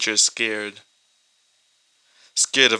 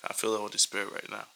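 much softer, fast, fighting off tears; holding back tears; 9 dB quieter than usual. Real, not acted. Preacher moved by the healing story, holding back tears. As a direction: swallow the tears and push the words out quickly. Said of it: "I'm trying not to cry".

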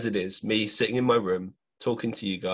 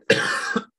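short, rough hacking cough; coughing fit; 5 dB louder than usual. Real, not acted. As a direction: cough hard mid-sentence.

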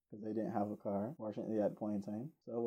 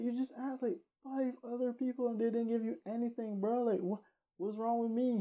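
much higher, indignant disbelief; incredulous protest; 11 semitones higher than usual. Retold or imitated. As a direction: push back, baffled and high. Arguing back at the beggar.

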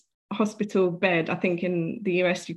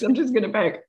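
ordinary delivery

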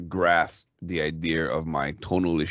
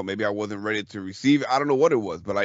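ordinary talk

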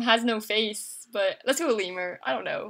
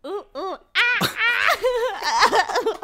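monkey noises; 9 semitones higher, much slower than usual. Pretend. Charades, making animal noises.

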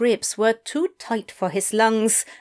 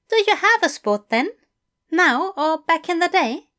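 high, eager English teacher; bright, keen invitation from a fussy gentleman; 7 semitones higher, 4 dB louder, faster than usual. A narrator's reading. Fell voice again.

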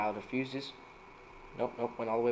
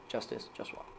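whisper; 2 semitones lower, 12 dB quieter than usual. Acted. Whispered pun explanation.

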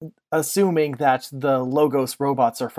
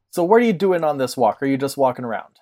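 ordinary talk, nothing marked

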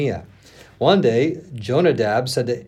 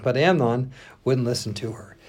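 ordinary delivery, nothing marked

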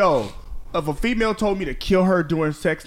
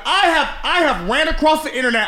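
excited rant; 7 semitones higher than usual. Real, not acted. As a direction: rant it all worked up. Heated rant about baddies.

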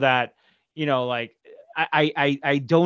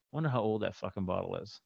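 much softer, lower musing; muttered to self; 5 semitones lower, 11 dB quieter than usual. Retold or imitated. Son eyeing the lonely tequila bottle, muttering softly. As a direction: mutter it to yourself, low and soft. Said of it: "and I'm going".

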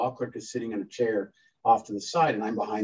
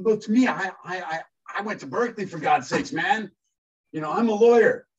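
higher, whiny protest; bratty indignant outburst; petulant child; 8 semitones higher, 4 dB louder than usual. Retold or imitated. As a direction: plead your credentials like a hurt kid. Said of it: "this petulant, you know, child".

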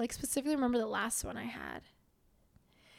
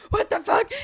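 loud, higher, outraged "What the fuck?"; shocked outburst; 9 semitones higher, 13 dB louder than usual. Retold or imitated. Girlfriend reacting to her laughing boyfriend, loud shocked yell. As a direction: blurt it out in disbelief.

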